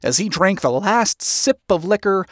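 ordinary delivery

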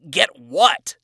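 loud, sharp retort; a furious demand; angry demand. Acted. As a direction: snap it back at her loudly. Adam angrily demands of Lilith.

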